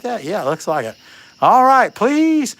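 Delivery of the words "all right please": high, loud pleading; pleading with bird. Real, not acted. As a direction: coax the bird to talk. Begging bird to talk.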